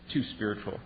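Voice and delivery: muttered, unvoiced sneer under the breath; mocking church critic. Churchgoers dismissing an enthusiast, sneering mutter. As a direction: sniff it off as too much. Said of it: "they go".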